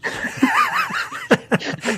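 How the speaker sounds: real high burst of laughter; amused laugh after the scotch story. Real, not acted.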